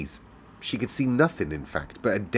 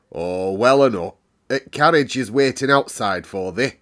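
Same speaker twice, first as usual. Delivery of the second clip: louder Yorkshire housekeeper reply; brisk Yorkshire answer; 6 dB louder than usual. Acted. Medlock tells station master the carriage waits, Yorkshire.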